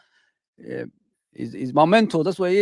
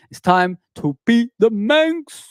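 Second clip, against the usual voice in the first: hype call; 8 semitones higher, 3 dB louder than usual. Real, not acted. Fan hyping the chat before the game, high rallying cry. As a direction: pump the fans up for the match.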